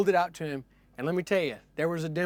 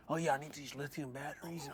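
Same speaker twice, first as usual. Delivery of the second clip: very soft put-on customer voice; furtive muttering; 3 semitones lower, 12 dB quieter than usual. Meth shopper browsing the kiosk, imitated very quiet mutter. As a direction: mumble it quietly to yourself.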